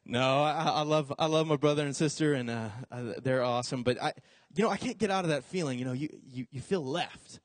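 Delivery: normal talking voice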